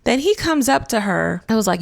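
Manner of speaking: ordinary talk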